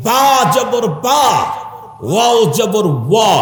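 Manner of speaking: ba and wa sounded out emphatically; Arabic ba versus wa. Shown as a demonstration. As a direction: drill ba, then rounded wa. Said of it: "ওয়া উচ্চারণ করার মতো".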